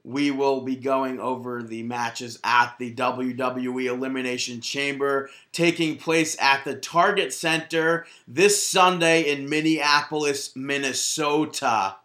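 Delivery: slow, deliberate show-host announcement; announcer read. Acted. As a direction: announce the card like a broadcast host.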